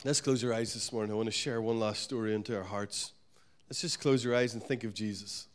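soft, low prayer voice; quiet prayer lead. Acted. Pastor leading church to close eyes, soft. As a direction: lead them gently into prayer.

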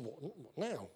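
soft, low, taken aback; startled question. Retold or imitated. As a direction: ask in quiet disbelief. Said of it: "She says".

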